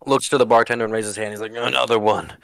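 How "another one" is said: lightly voiced town drunk, words slurred; slurring drunk. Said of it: "clearly slurring his words".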